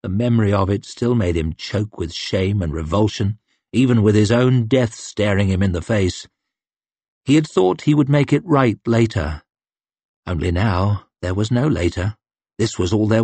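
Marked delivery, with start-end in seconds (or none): none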